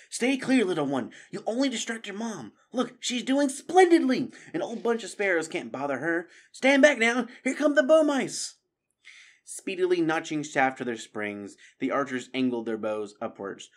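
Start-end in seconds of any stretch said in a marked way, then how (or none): 0.1-8.6 put-on high, raised voice cautioning a child; insistent, excited guardian; urgent reasoning. A narrator's reading. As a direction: urge the little one to stay back. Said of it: "attempted to reason with Sam".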